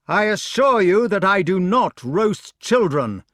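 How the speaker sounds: high, loud denial. A narrator's reading. Accused knight up a tree to his attacker, protesting loudly. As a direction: call down an earnest denial.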